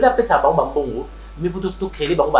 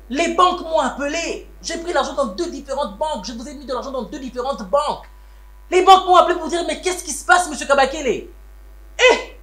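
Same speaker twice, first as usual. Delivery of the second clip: high, rising indignant rebuke; angry scolding; 7 semitones higher than usual. Acted. Rebuking the family over the vanished money, angrily.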